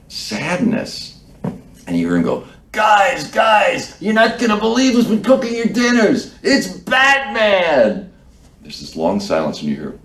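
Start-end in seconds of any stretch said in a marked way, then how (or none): 2.7-8.1 high, loud calling out to a whole room; excited announcement. Retold or imitated. Volunteer calling to the dining hall crowd, high and loud. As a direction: shout the big news to everyone, stretch out Batman.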